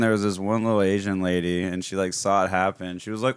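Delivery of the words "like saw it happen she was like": usual speaking voice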